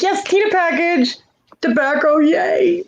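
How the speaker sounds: high-pitched excited squeal over a delivery. Retold or imitated. Woman retelling her rush to the porch for a package, excited. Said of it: "I'm all excited".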